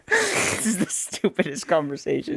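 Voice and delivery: talking through real laughter; laughing. Real, not acted. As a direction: laugh helplessly while getting the words out. Laughing at the absurd argument.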